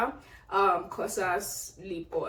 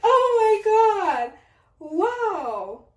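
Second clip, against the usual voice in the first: high, louder, near tears; overwhelmed, voice almost crying; teary surprise; 12 semitones higher, 6 dB louder, much slower than usual. Real, not acted.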